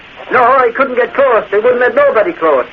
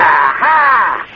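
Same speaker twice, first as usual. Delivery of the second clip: triumphant aha; 3 semitones lower than usual. Acted. Catching her in a lie.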